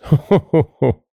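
high ho-ho laugh; acted laughing. A narrator's reading. Sexton amused at a coffin at Christmas, acted laugh. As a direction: play a chuckle at one's own joke.

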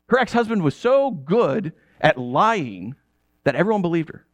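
usual speaking voice

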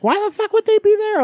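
high, shrill-voiced mimic of an annoyed teen; high mocking teen voice; mocking daughter. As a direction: squeak it out like a put-upon kid. Daughter quoted exasperated.

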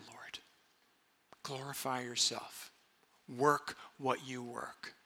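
hushed, low plea with long pauses; quiet, trusting appeal to God; earnest prayer. Shown as a demonstration. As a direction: pray softly, almost whispering at times. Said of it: "will you pray this this week".

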